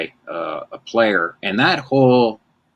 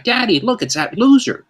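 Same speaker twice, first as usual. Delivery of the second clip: put-on high, piping little girl, louder on loser; toddler voice; 3 dB louder than usual. Toddler keeps pointing out the big fan, high and piping. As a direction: chirp it out like a proud two-year-old. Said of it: "She's like".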